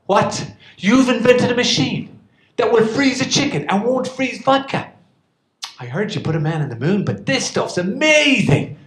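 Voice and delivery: fooling the host about fridges, hammed-up amazement; mock astonishment. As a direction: ham up being amazed. Feigning amazement at vodka.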